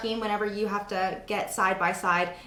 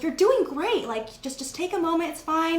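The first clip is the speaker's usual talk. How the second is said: reassuring director; 8 semitones higher than usual. Retold or imitated. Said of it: "the casting director was like".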